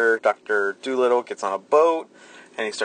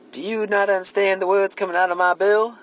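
higher, put-on duck voice; mock sassy duck; 8 semitones higher than usual. Quoting the duck in the movie, mock sassy voice.

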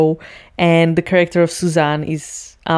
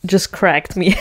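ordinary talk, nothing marked

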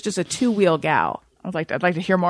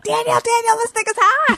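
squeaky mock voice; 14 semitones higher than usual. Clip drop in a voice.

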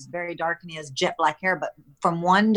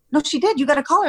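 higher, louder insistent correction; retold insistence; 8 semitones higher, 4 dB louder, much faster than usual. Wife telling husband his mom called, insistent. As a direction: correct him firmly, replaying the exchange. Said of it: "And I go".

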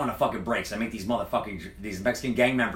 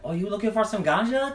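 bartender's offer; 11 semitones higher, 3 dB louder than usual. Retold or imitated. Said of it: "she's like".

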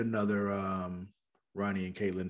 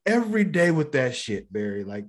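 raised pitch, hitting each complaint then dropping on the name; mock exasperated rant; 6 semitones higher, 4 dB louder than usual. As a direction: act out a fed-up nightly complaint. Acted nightly rant at Barry.

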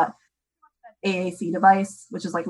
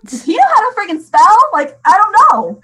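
much higher and louder, stunned disbelief; astonished; 10 semitones higher, 8 dB louder than usual. Real, not acted. Mom stunned her son can spell, high and loud. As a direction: burst out in amazed disbelief.

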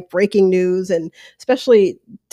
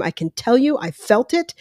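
ordinary talk, nothing marked

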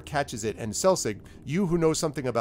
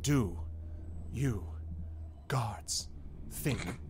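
isolated words with long pauses between; one word per step; 8 dB quieter, much slower than usual. Acted. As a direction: let each word land alone, like a footfall. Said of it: "takes every word as a step".

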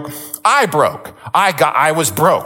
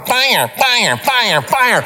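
mock alarm voice; 8 semitones higher, much slower than usual. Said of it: "she's, like, still going".

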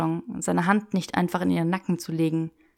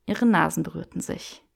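plain reading voice

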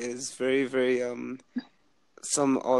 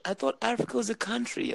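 mock clueless girl; 10 semitones higher, 4 dB quieter than usual. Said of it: "I was like".

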